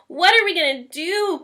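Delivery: loud, high, worried cry; a mother crying out for her daughter; distressed cry. A narrator's reading. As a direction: wail it out in a panic. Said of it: "cried Miss Cream".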